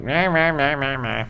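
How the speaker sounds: high whiny meh meh, mocking a complainer; mock whining. Shown as a demonstration. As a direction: whine meh meh like someone nagging. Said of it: "Complaining about is more like".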